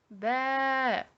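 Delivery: ba with the a held long; slow, stretched ba; long vowel. Shown as a demonstration. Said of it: "deux fois plus long qu'une voyelle brève".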